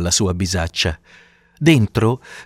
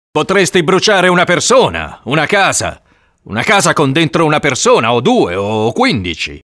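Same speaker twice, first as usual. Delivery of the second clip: alarmed argument; 6 semitones higher than usual. Acted. Man argues elf could burn people.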